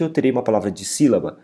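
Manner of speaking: ordinary delivery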